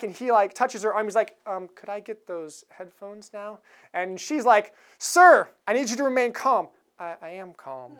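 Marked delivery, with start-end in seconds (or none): 1.5-3.6 meek request. Retold or imitated. Meek passenger asks again. 5.0-6.7 stern attendant. Retold or imitated. Flight attendant scolds passenger. 7.0-7.9 much softer, tight forced calm with a laugh; strained calm. Retold or imitated. Passenger insists he is calm.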